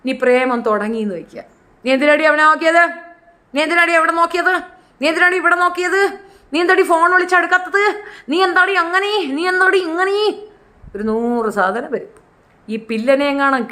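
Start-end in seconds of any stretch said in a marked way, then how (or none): 1.9-10.4 mock jealous nagging. Jealous boyfriend questioning his girlfriend, mock nagging. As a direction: nag her like a jealous boyfriend.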